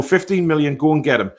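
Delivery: ordinary talk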